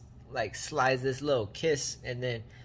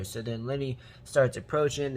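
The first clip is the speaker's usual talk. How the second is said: ordinary delivery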